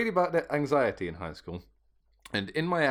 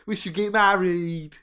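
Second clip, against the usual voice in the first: high fake British with laughter; mock British accent; 9 semitones higher, 4 dB louder than usual.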